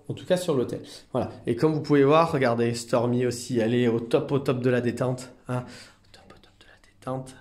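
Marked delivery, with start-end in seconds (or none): 5.6-7.3 hushed unvoiced whisper. Real, not acted. Carer showing Stormy totally relaxed, soft whisper. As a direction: whisper it so the relaxed animal stays undisturbed.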